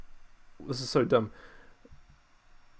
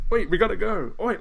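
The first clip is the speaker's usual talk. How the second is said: higher, louder urgent imitation; mock calling out; 8 semitones higher, 5 dB louder than usual. Characters trying to get her attention, mimicked urgent call. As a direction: call after someone wandering off. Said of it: "trying to get her attention".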